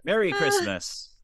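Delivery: very high, slow and loud "Merry Christmas"; cheery greeting. Pretend. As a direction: squeal it like a jolly card.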